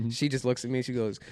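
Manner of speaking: ordinary talk, nothing marked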